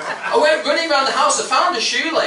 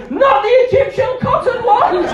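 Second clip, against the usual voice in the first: much higher, louder, slow shout from another room; wife yelling to save her good towels; high, loud, drawn-out yell of protest; 12 semitones higher, 6 dB louder than usual. Retold or imitated. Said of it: "a voice from outside going".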